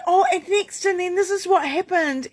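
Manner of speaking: put-on high, eager kid voice retelling their day; imitating an excited kid. Mother voicing her kids' dinner-table stories, high and eager. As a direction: chirp it out like a child bursting with news. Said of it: "and they're going".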